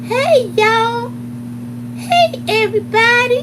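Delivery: high, loud sing-song hello; imitating Lucretia's greeting. Teacher walks into the room greeting everyone, high valley voice. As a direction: call out to the room like a bubbly teacher. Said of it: "That's how she used to walk in the room".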